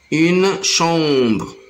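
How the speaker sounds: ordinary delivery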